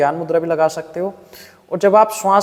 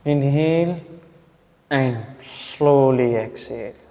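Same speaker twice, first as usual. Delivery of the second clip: very slow, low, softer breathing cue; guided breathing; 4 semitones lower, 4 dB quieter, much slower than usual. Shown as a demonstration. Teacher guiding students through a slow breath, calm and drawn out. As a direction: stretch the exhale out, guiding them down. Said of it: "मतलब इस तरह से".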